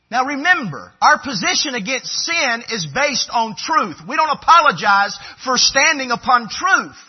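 loud, high, driving sermon voice; forceful preaching. Acted.

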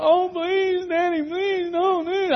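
high, loud, pleading wail; mock weeping. Boys begging Dad not to leave their sister with them, mock weeping. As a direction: imitate panicked children crying to their father. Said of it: "they started weeping and crying".

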